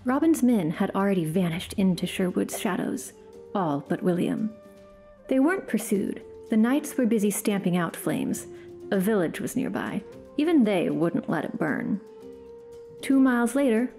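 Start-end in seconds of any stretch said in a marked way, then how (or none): none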